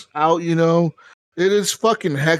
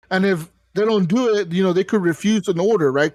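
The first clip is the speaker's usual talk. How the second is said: ordinary talk, nothing marked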